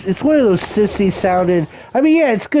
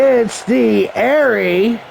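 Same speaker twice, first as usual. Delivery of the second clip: drawn-out, higher ring introduction; ring announcer; 4 semitones higher, much slower than usual. Pretend. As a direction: stretch out the wrestler's entrance call.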